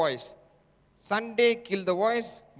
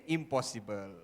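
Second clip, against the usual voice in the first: doubting inner voice; 8 semitones lower, 7 dB quieter than usual. Acted. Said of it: "He will convince you and say".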